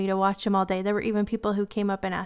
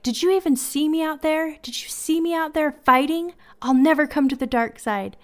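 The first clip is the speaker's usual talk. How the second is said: put-on higher and louder, a boy outraged he went unnoticed; indignant kid; 6 semitones higher, 5 dB louder than usual. Little nephew insisting to Darth Vader he fought, indignant. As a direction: demand it like a proud little warrior.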